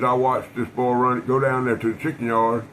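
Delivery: ordinary delivery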